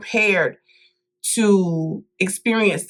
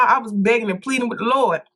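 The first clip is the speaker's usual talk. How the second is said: normal talking voice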